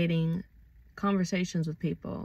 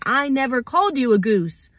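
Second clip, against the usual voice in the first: louder, higher acted protest; indignant denial; 5 semitones higher, 5 dB louder than usual. Acting out someone wrongly accused of name-calling, indignant. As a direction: push back hard against a false accusation.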